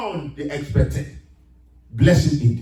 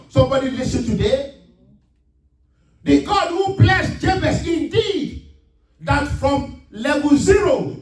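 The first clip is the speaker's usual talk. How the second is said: fervent preaching; 7 semitones higher than usual. Real, not acted. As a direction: proclaim it to the whole church. Preacher exhorts congregation.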